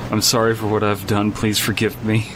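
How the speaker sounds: ordinary talk, nothing marked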